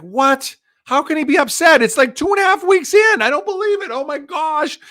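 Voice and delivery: very high, acted panicked squeal; hammed-up high-pitched disbelief; freaked out. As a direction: play your younger self in a comic panic. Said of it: "I was all freaked out".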